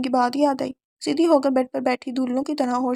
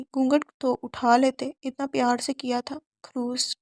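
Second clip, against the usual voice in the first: ordinary delivery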